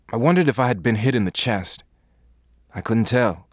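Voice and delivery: plain reading voice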